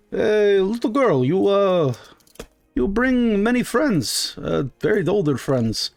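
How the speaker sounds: high, slow, halting merchant voice; marble-mouthed shopkeeper, curious. Acted. Shopkeeper asking little girl about her friends, slow and lilting.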